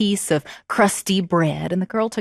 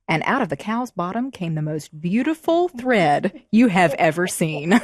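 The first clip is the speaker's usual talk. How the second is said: usual speaking voice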